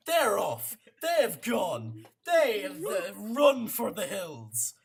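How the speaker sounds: loud, slow, dramatic telling; excited narration. A narrator's reading. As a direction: paint the rout with gusto. DM narrates enemies fleeing.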